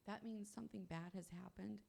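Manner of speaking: usual speaking voice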